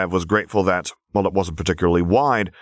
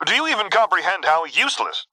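high-pitched, frantic rant; shrill freakout; 12 semitones higher than usual. A narrator's reading. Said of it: "Quinn's voice was shrill enough".

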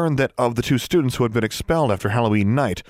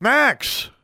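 shout. A narrator's reading. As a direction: yell his name to stop him.

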